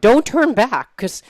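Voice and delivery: high, loud mock alarm; rising, loud retold warning; dramatic warning. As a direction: warn them with hammed-up urgency. Voicing the woman's dramatic warning.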